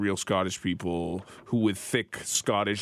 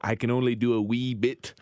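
light Scottish lilt; a wee touch of Scottish; attempted Scottish accent; 2 semitones higher than usual. Shown as a demonstration. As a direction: try a tentative Scottish accent. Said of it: "How's your Scottish accent?".

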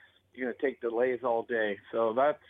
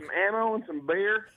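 higher-pitched hammed-up Texas accent; 6 semitones higher than usual. Shown as a demonstration. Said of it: "give me some stereotypes".

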